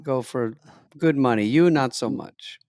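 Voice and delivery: put-on slow lightly Kazakh accent; slow, halting Borat accent; Borat impression. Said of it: "Borat impression".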